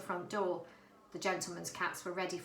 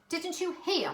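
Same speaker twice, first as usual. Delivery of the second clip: protesting; 9 semitones higher, 4 dB louder than usual. A narrator's reading. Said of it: "protested Varjak".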